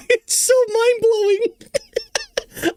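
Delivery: high, slow, wheezing through laughter; can barely get it out for laughing. Real, not acted. Host to viewers, amazed by the clip: laughing wheeze.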